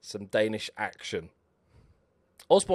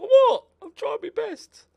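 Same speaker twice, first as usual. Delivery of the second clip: squeaky, much higher hurt footballer; mock player; 9 dB louder, slower than usual. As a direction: whine it like a wounded player protesting. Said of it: "He's like".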